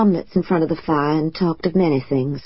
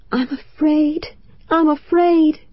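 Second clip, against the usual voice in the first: frightened; 10 semitones higher, slower than usual. Acted. Frightened cry in the dark.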